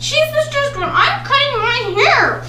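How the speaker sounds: high, loud exasperated protest; loud and indignant. Real, not acted. Abandoned mid-haircut.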